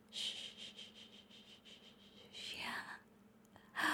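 soft, breathy, drawn-out shushes; very soft soothing shh whispers; shushing whisper. Acted. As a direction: hush them gently, barely voiced. Soothing shushing in intimate roleplay.

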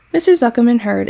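plain reading voice

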